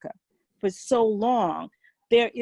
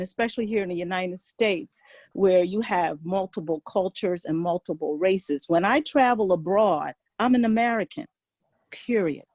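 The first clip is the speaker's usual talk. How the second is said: ordinary talk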